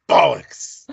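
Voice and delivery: one-word English accent exclamation; mock English accent. Shown as a demonstration. Host gives up on the accent attempt, mock English. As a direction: swear in put-on English.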